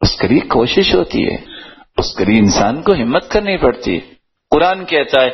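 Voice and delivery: ordinary delivery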